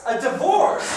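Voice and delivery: high, loud incredulous question; startled yelp rising at the end; shocked. Acted. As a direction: echo it in stunned disbelief. Husband shocked by demand.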